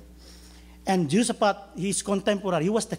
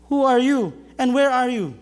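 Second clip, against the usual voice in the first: high, loud call to an unseen voice; stranded man shouts to the voice above, loudly; shouting up; 7 semitones higher, 6 dB louder than usual. Acted. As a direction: yell the question up the cliff.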